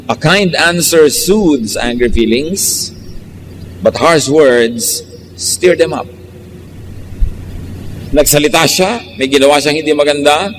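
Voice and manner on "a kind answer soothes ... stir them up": slow, measured reading of the proverb; slow scripture recitation. Shown as a demonstration. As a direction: read the verse slowly and deliberately. Reads a Bible proverb aloud.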